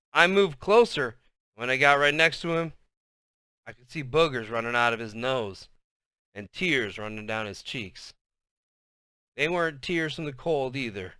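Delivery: ordinary delivery, nothing marked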